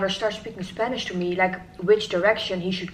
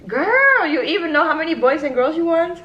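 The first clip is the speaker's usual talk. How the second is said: surprised; 7 semitones higher, 4 dB louder than usual. Real, not acted. Reacting to five kids plan.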